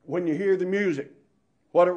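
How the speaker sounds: ordinary talk, nothing marked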